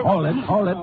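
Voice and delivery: sharp, high call to stop a scuffle; urgent command. Acted. Ranger stopping the suspect from lunging at the witness, urgent high command. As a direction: break up the fight with your voice.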